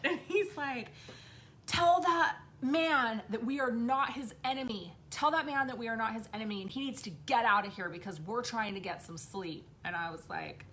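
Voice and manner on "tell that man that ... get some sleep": put-on insistent child. Child telling mom about the man, insistent. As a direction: firmly order it like an indignant kid. Child orders spirit away, retold.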